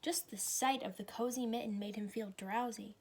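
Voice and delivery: plain reading voice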